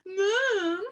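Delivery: high, drawn-out wailing call; aggrieved little kid calling; calling for Mum. A narrator's reading. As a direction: whine out for Mum like a tattling child. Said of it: "went running off calling".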